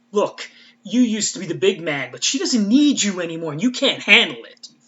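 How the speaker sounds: loud, angry telling-off with a mock British edge; cussing out. Spike cussing out Rupert Giles, loud and angry. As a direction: tear into them like Spike would. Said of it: "cusses them all out".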